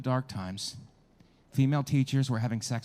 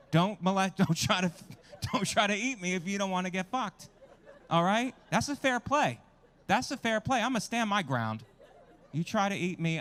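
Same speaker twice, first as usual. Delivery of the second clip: mock-defiant outburst; 7 semitones higher, 3 dB louder than usual. Ranting at an imagined alligator.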